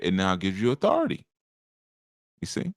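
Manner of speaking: usual speaking voice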